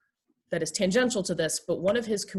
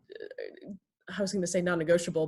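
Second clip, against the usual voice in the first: ordinary talk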